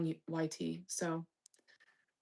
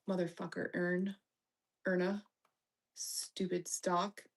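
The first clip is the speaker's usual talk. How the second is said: ordinary talk, nothing marked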